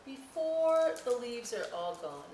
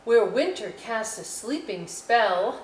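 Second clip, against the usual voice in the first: louder, lilting poem line; poem reading; 3 semitones higher, 10 dB louder than usual. Acted. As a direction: recite it like a spell.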